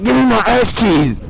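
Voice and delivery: drawn-out, high mock shout; mock yell. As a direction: bellow it slowly as a hammed-up demand. Tackler yelling, improvised skit.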